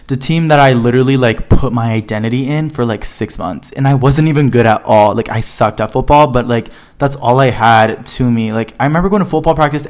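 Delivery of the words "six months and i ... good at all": normal talking voice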